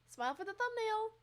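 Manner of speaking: high chirpy coaxing; high pet voice. Real, not acted. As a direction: coax the cat to smile. Talking to cat on camera.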